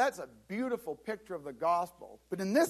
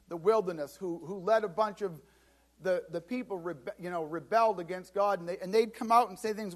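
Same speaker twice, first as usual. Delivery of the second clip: ordinary talk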